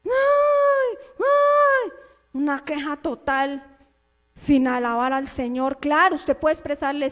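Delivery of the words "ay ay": high piercing mock wail "¡Ay!"; mock wailing cry. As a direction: imitate a whiny complaining wail. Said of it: "Eso allá chillando". (lamento burlón agudo)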